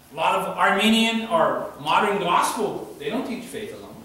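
loud, high, ringing sermon delivery; full-force preaching. Acted. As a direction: preach it out to the back pews with fire. Preacher pressing on faith alone.

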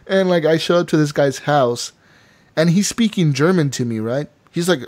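usual speaking voice

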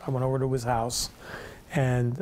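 ordinary talk, nothing marked

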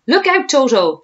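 high, loud urgent warning; shouted warning. A narrator's reading. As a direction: yell to save the children. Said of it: "shouted Humpy Rumpy".

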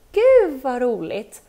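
loud, slow, delighted gush; teacher's loud drawn-out delight at Julian's visit; delighted welcome. Acted. Teacher greets visiting boy.

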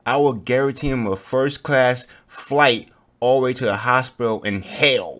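loud, angry warning; loud threat. Real, not acted. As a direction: bellow the threat. Threatening character who might betray.

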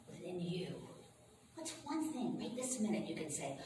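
soft, hushed meditation voice with long pauses; guided meditation. Real, not acted. Minister leads congregation to gratitude, soft guided meditation. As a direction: guide them softly into peace, letting words trail.

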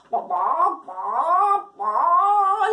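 cacareo que sube y se hace más fuerte; cacareo creciente. Acted. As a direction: imita a la gallina a punto de poner. Gallina a punto de poner. (cacareo que va subiendo)

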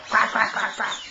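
low playful duck quacks; squeaky rubber duck. Pretend. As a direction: quack like a toy duck for the baby. Mom voicing the duck toy.

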